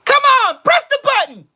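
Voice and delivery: very high, strained mock shout; taunting yell. Daring someone to press the button, high taunting shout. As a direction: egg them on in a high yell.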